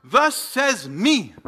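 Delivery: "Thus says me" slow, each word weighted, falling; stress on Thus and me. Shown as a demonstration. Preacher voices Jesus' authority, slow and weighty. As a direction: lean hard on "Thus says me" slowly. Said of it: "In other words".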